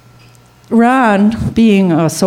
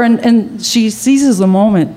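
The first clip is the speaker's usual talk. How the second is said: plain reading voice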